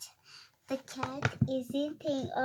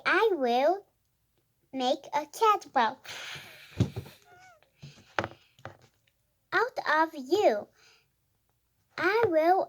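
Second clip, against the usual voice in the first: animated story reading; 6 dB louder than usual. Acted. Reader voicing a quarrelling cat and dog, animated read-aloud. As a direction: read it out clearly, pitch jumping up on woofs and meows.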